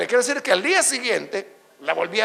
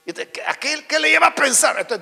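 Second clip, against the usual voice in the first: usual speaking voice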